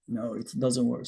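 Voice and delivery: usual speaking voice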